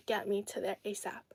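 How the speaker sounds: hurried whispered plea; whispered aside. Retold or imitated. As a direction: whisper it like a secret demand. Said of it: "I was like".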